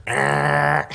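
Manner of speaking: loud buzzing game-show wrong-answer noise; Family Feud buzzer. Retold or imitated. Preacher mimicking the game-show buzzer, loud nasal eh. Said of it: "that graphic that comes up at Family Feud".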